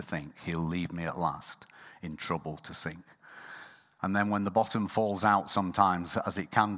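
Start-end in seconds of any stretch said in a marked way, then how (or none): 0.0-3.0 whispered. Real, not acted. Quiet line ending.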